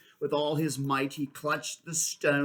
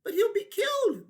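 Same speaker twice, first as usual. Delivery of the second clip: high, loud anguished creature voice; high pained moan; 6 dB louder than usual. Acted. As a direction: wail the fear. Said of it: "they moaned".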